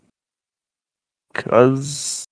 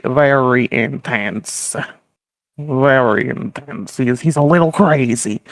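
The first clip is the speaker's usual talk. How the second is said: usual speaking voice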